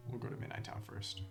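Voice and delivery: usual speaking voice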